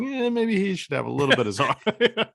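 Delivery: high, laughing mock suggestion; joking aside. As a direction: toss it off with a laugh. Joking about sedating the kid.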